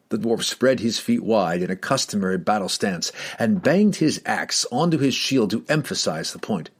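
usual speaking voice

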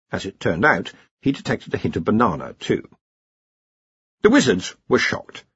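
ordinary delivery, nothing marked

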